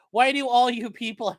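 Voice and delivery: normal talking voice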